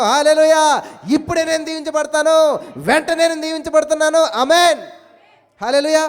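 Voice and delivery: loud, high declaration; preacher lifting the crowd; fervent preaching. Real, not acted. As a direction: proclaim it with full force.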